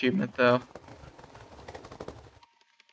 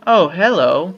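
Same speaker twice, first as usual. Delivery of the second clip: surprised greeting; 3 semitones higher, 12 dB louder, slower than usual. Real, not acted. Startled by a villager.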